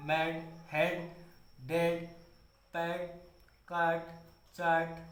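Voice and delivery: फैट, शेड, मैड, कैप read slowly and softly, the "आ" sound drawn out; slow word list. Shown as a demonstration. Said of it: "सभी में आ का उच्चारण".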